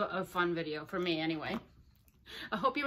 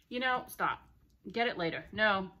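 usual speaking voice

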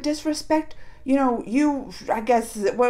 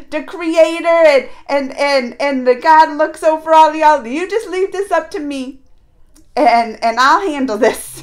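Krishna voiced louder, insistent and sure; lightly voiced god taking charge, louder; emphatic Krishna; 3 semitones higher, 11 dB louder than usual. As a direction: tell him to leave it to the pros. Krishna reassures Arjun, voiced loudly.

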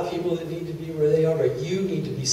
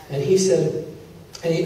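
ordinary talk

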